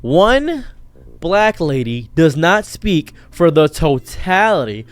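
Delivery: usual speaking voice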